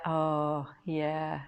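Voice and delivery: ordinary talk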